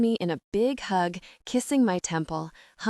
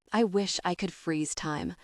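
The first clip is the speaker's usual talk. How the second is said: ordinary delivery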